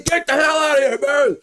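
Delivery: high, full-force yell at a bear; shouting. Shown as a demonstration. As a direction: demonstrate scaring it away with a yell. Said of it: "You gotta shout it".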